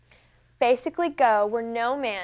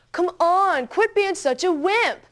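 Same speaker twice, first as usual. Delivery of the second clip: higher, louder mocking yell; yelling taunt; 7 semitones higher, 5 dB louder, slower than usual. Older brother jeers at the frozen girl, yelling loudly. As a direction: taunt her loudly from the log. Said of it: "And John yells".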